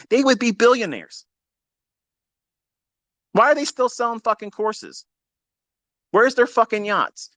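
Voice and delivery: high, scornful outburst; angry rant. Real, not acted. To the audience, mocking the course sellers, in an angry high rant. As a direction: blast the course sellers with contempt.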